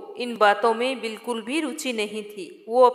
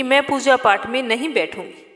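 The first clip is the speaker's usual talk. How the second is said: ordinary delivery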